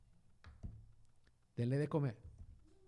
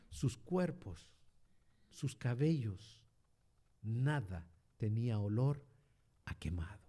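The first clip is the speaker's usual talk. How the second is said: slow, much softer and lower; hushed awe; 8 semitones lower, 8 dB quieter, slower than usual. Real, not acted. Preacher revealing that nothing smelled burned, hushed and slow. Dramatic climax of the miracle.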